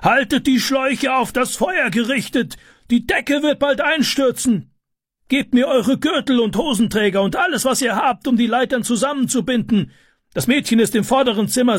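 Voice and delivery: sustained high, urgent calling. A narrator's reading. Neighbour directs helpers with hoses and ladders, calling out. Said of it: "rief er".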